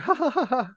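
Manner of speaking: high, breathy nervous laughing. Shown as a demonstration. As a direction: fake a nervous little laugh. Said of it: "you do the nervous laughter after".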